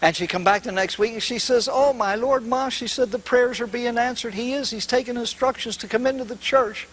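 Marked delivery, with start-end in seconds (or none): 1.7-6.9 put-on excited girl. Excited daughter bursting with news.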